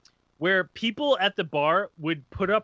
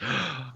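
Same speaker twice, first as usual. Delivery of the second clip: imitated sharp gasp; shocked gasp; 4 semitones lower, 8 dB quieter than usual. Buddies seeing Mister Conspiracy step in, shocked gasp. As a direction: gasp like horrified friends. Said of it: "had, like, this".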